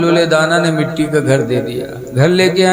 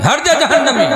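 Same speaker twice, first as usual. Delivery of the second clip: higher, laughing mock scold; wife snapping in sleep; 8 semitones higher, faster than usual. Zubaida dismissing Haroon from her dream, laughing scold. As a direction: brush him off half-asleep. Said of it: "तो जुबैदा कहती हैं".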